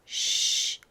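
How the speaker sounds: unvoiced hush; soft warning shh; shush. A narrator's reading. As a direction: shush them so nobody wakes. Don't wake the sleeper.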